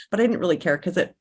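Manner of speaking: usual speaking voice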